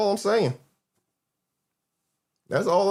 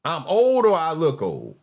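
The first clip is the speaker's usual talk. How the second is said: slow pointed question; 3 semitones lower than usual. Real, not acted.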